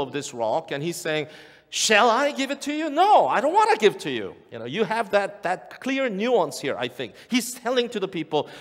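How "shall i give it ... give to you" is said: high, loud, teasing withholding; taunting refusal. Retold or imitated. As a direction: dangle it then refuse. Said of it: "he has the control".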